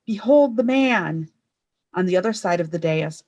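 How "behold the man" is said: loud, slow proclamation; a governor presenting the prisoner; shouting. A narrator's reading. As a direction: announce it to the whole crowd.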